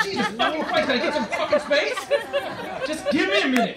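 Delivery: high, loud mock outburst; hammed-up frustrated shout; exasperated yell. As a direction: blow up at the toddler. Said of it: "and I'm like".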